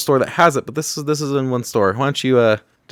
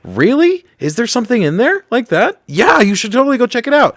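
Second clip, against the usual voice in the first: much higher, thrilled and breathless; excited shopper voice; 12 semitones higher than usual. Retold or imitated. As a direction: squeal it like eager gossip. Imagined shoppers chatting.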